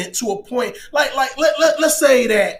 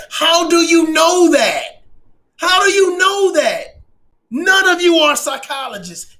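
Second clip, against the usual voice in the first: indignant challenge; 4 semitones higher, 4 dB louder than usual. Real, not acted. Commentator challenging armchair critics, indignant. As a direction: hammer each word of the question, indignant.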